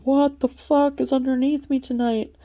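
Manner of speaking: the moon reacting in horror to a dancer below. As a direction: play the moon, appalled at what it sees. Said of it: "Here's the moon going".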